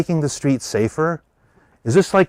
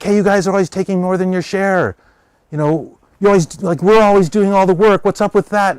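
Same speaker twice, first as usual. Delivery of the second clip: higher and louder accusing; indignant confrontation; 8 semitones higher, 5 dB louder than usual. Retold or imitated. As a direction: call out the bosses for taking too much. Pawns confronting their oppressors.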